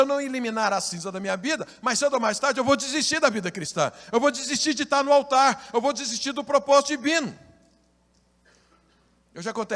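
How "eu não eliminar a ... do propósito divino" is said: high, loud pulpit proclamation; preaching at full force. Acted. Pastor preaching loudly to the congregation about giving up. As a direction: preach it out to the church.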